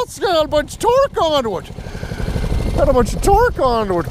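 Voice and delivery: high-pitched excited hollering at the tractor; squealing play-by-play as it takes off; excited cheering. Real, not acted. Cheering tractor pulling the load.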